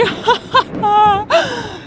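high laughing brag, drawn out slow; gloating laugh. Acted. Rouge gloating to Knuckles after the theft, laughing. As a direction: crow about it through a laugh.

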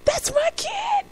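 singing father mock-sobbing over his son; mock bawling. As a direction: howl it like a weeping dad. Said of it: "And he's still saying".

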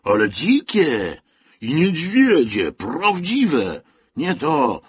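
loud, high, drawn-out insistence; emphatic scaring. A narrator's reading. Boy boasts of real bears to little one, scornfully emphatic. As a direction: stretch it out to impress a little one.

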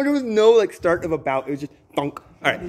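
ordinary talk

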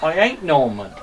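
broad Somerset farmhand voice, kept up all through; Somerset accent. Acted. Farmer showing off his dog to the camera, in a broad Somerset accent. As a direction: play a rustic Somerset yokel who's chatting away. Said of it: "See if I got the accent again".

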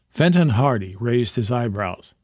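ordinary delivery

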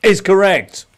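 loud, high announcement of a right answer; emphatic quizmaster call. Real, not acted. Quizmaster confirms Daniel's correct answer, emphatic loud call. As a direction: call it out big, pitch jumping up.

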